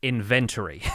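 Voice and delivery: "inventory" stressed on VEN, in-VEN-tree; stress on second syllable. Shown as a demonstration. Host showing his own way: "inventory" with stress on VEN. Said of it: "I put the emphasis on the second syllable".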